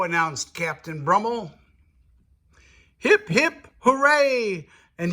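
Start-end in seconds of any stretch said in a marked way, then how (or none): 3.0-4.7 high, loud hip hip hooray; cheering. Acted. Pirates cheering as they rush to sail, high and loud. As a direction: lead the cheer like a crowd. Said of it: "they all shouted".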